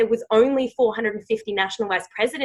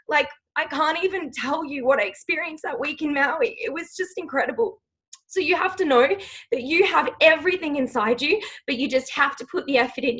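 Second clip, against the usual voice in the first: emotional pep talk; 8 semitones higher than usual. Real, not acted.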